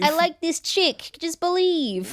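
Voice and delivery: high, slow goofy Luigi voice; mock Luigi. Podcast cohost mocks Luigi in a high, slow voice. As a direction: ham up the lovestruck brother.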